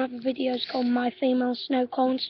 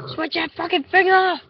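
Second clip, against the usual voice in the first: louder, higher hammed-up threats; shouting fake threats at the camera; mock angry rant; 5 semitones higher, 8 dB louder than usual. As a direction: rage at the viewers like a pretend thug. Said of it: "I'm getting angry now".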